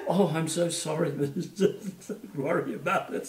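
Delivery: a lightly voiced embarrassed teacher saying sorry; mortified directress. Directress apologising to the puppeteers, a hint of fluster. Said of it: "kindergarten directress was mortified".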